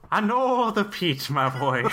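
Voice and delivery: slow, high-starting fake Irish lilt; mock Irish accent. Host takes his turn at Irish, hammed-up accent. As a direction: badly fake Irish, stretching the first words. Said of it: "Okay, Cameron?".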